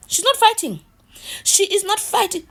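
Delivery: high loud disbelieving cry; high loud excited exclamation; excited exclaim. Real, not acted. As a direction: shout it out in amazement.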